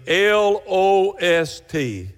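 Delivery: L O S T slowly spelled letter by letter; each letter of L O S T said slowly on its own. Shown as a demonstration. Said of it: "spells the word out".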